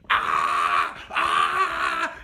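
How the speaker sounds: long, loud drawn-out "aah" yells; mock scream. Driver imitating a woman passenger in the back seat, screaming. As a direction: imitate the passenger wailing at full volume.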